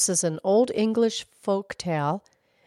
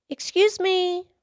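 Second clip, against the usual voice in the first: high, drawn-out polite call; high-pitched child getting attention; little girl calling; 3 dB louder, slower than usual. Acted. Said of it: "she called to the old mill wheel".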